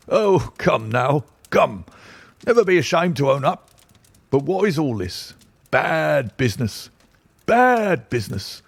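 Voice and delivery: high, blustering police inspector; bluff, self-satisfied inspector; pompous inspector. A narrator's reading. Athelney Jones speaking, pompous.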